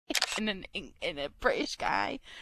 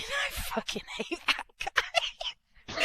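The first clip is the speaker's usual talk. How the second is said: mock high voice; slower than usual. Repeats line in mocking high voice.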